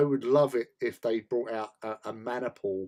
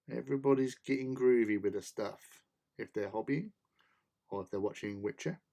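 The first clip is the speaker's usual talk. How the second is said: ordinary talk, nothing marked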